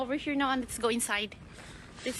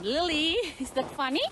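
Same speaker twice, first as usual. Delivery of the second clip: ordinary delivery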